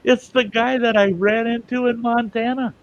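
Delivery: can't believe your luck and let it show; amazed disbelief. Real, not acted.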